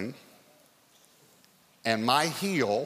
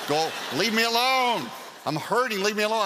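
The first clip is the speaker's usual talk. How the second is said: high, loud cry of a hurt wife; mock pained yell; 9 semitones higher, 4 dB louder than usual. Wife in pain shouts him away, high loud yell.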